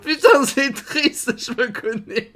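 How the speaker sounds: laughing at himself. Real, not acted. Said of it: "ça me fait rire".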